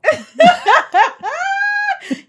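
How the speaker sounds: a burst of high, loud cackling laughter; loud high shrieking laugh. Real, not acted. Cracking up at her own joke, loud shrieking laugh.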